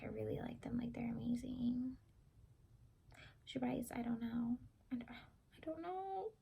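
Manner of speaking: soft shy whisper. Shown as a demonstration. Answering the judges about Red Velvet, in a shy whisper. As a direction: whisper it bashfully, barely voiced. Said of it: "I said in a shy voice".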